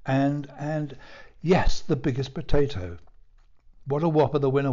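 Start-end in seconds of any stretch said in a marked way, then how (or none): none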